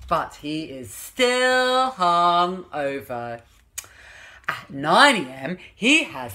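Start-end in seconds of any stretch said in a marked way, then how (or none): 0.1-3.4 but he is still hungover, dragged out slowly; storybook refrain, dragged out. A narrator's reading. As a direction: draw out still like a weary chorus.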